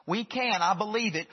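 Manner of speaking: ordinary delivery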